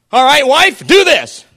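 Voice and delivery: lightly voiced demanding husband; the law as husband. Voicing the law commanding wife.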